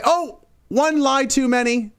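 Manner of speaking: high, drawn-out fake gasp of realisation; mock shock. Opposition finally noticing the lies, mock shock. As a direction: act suddenly scandalised, slowly.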